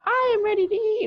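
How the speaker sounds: squeaky mock falsetto; Sophie. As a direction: put on a very high little character voice. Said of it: "Sophie, I think her name is".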